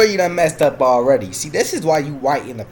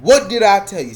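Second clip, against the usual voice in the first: loud, raised-pitch "told you so"; vindicated exclamation; 3 semitones higher, 6 dB louder than usual. Real, not acted. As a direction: call it out loudly, proved right. Exclaims at being proved right.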